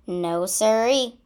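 ordinary delivery

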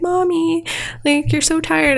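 put-on high childlike voice; a small girl's voice, mildly imitated; little daughter voice. Little daughter tells her tired mom, in a mild high child's voice. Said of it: "her daughter's like".

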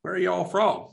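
a hint of a southern US accent, half-attempted; southern drawl. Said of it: "like a southern drawl kind of accent".

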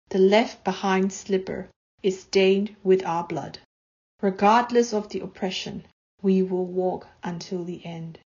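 deliberate, paced verse with weighty pauses; poem reading. Acted. Poet reading her protest poem in English to listeners, measured. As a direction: let each line land before the next.

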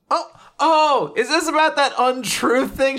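high, surprised oh; mock realisation. Shen realising why Fuko refused, high mock surprise. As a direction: act like it just dawned on you. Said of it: "he's like".

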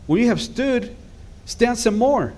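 fervent preaching. Real, not acted. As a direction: build to the peak of the sermon. Preacher rising to a climax.

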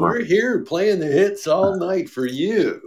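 over-the-top late-night DJ; radio DJ voice. Pretend. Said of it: "that was my horrible radio voice".

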